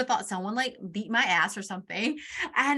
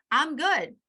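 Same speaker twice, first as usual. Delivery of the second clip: mock defensive; 5 semitones higher than usual. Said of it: "I got defensive".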